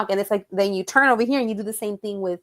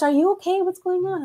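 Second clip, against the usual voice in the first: much higher worried voice; imitating him; 11 semitones higher than usual. Man chasing her, gathering makeup, mock-worried high voice. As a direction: mimic a flustered man chasing after her.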